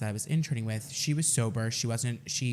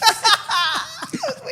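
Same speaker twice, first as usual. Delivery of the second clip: high laugh; 7 dB louder than usual. Real, not acted. Laughs at his own story.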